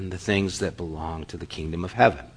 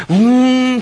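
high, loud engine vroom; 8 dB louder than usual. Retold or imitated. Youth pastor revving his truck to rescue Mark, loud engine vroom. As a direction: rev it like a little truck.

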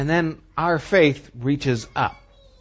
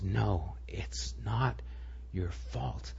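whispered, much softer, the word not leaned on; intense whisper; 7 semitones lower, 15 dB quieter, slower than usual. Retold or imitated. Professor pressing the grieving student, an urgent retold whisper. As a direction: breathe it right in his face, slow. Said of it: "Robin Williams gets in his face".

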